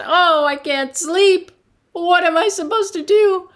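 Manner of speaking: angry complaint. Acted. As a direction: storm in and blame the wise man. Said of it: "Peter was angry now".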